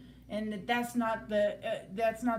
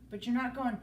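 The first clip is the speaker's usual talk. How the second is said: ordinary talk, nothing marked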